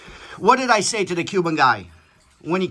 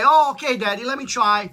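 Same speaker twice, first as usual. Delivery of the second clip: mock good kid; 6 semitones higher, 3 dB louder, faster than usual. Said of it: "The normal kid would say".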